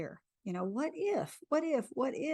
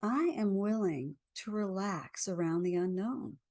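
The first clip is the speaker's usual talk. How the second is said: guided affirmation. Acted. Leads a tapping affirmation.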